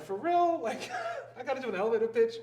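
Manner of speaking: high, loud incredulous question breaking into laughter; laughing disbelief. Retold or imitated. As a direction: replay being stunned by the demand. Retold disbelief at sudden demand.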